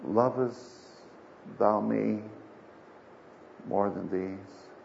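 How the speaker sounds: slow, low, hushed question with long pauses; Jesus asking gravely. Acted. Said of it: "he asked him the third time".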